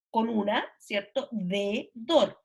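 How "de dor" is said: "De, dor" as two separated syllables. Shown as a demonstration. Explaining a hard-to-spell word, "De, dor" syllable by syllable. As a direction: sound out "De", pause, then "dor". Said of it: "es una palabra difícil de escribir".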